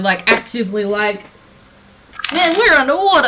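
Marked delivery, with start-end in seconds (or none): 2.3-3.3 thrilled, singsong outburst; excited exclamation. Acted. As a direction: whoop it like an amazed adventurer. Game master voices the players' wonder.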